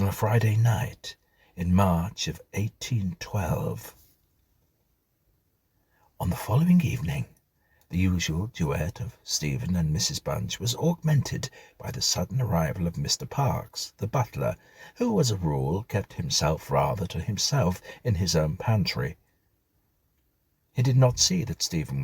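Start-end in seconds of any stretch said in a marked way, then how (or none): none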